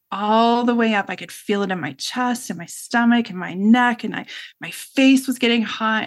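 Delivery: usual speaking voice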